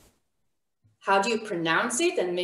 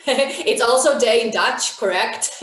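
amused, chuckling through the words; louder, giggly delight; laughing; 3 semitones higher, 5 dB louder than usual. Real, not acted. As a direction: let a real laugh break out around the words. Amused by a student's chat answer.

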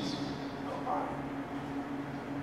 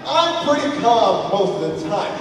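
ordinary talk, nothing marked

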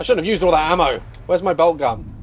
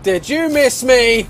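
higher, drawn-out taunt; raised, slow mocking call; 7 semitones higher, 4 dB louder, slower than usual. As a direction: call it out slowly to goad them.

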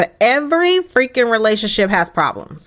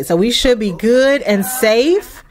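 usual speaking voice

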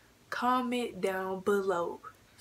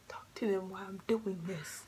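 whispered aside; 5 dB quieter than usual. Real, not acted.

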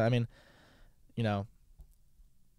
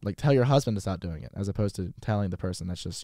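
ordinary talk

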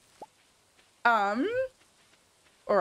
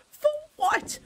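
outraged. Real, not acted. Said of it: "charged me a hundred".

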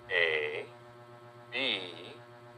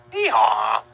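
high, loud hee-haw; donkey bray; 7 dB louder than usual. Shown as a demonstration. Teacher showing children a donkey, braying hee-haw. Imitates the donkey just spelled.

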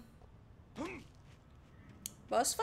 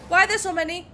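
panicked; 7 semitones higher, 7 dB louder than usual. Real, not acted. Swarmed by enemies.

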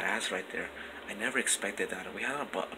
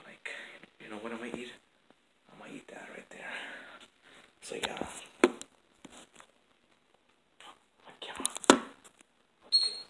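whispering. Real, not acted. Whispering at night in the house.

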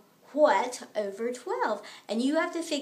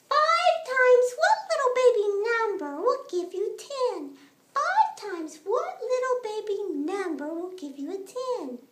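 put-on baby voice; 6 semitones higher, 3 dB louder than usual. Teacher voicing Baby for kids.

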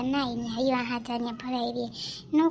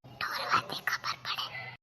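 whispered aside; 7 dB quieter, slower than usual. Acted.

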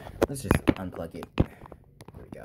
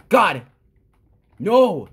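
dismayed shout; 5 semitones higher, 7 dB louder than usual. Real, not acted. Losing in the game.

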